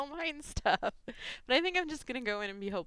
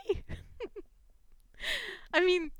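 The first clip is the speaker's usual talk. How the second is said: normal talking voice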